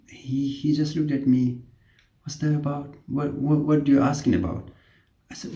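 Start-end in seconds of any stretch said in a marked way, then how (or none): 2.2-4.7 higher, lightly imitated puzzled patient. Patient confused by incomplete question, puzzled voice. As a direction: echo the question back bewildered.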